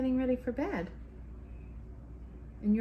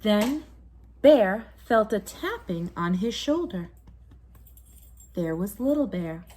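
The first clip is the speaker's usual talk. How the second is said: story narration; 3 semitones lower, 6 dB louder than usual. A narrator's reading. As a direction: tell it like a bedtime story to a child. Bedtime story narration continues.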